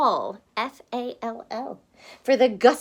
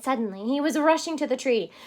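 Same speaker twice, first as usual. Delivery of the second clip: ordinary delivery, nothing marked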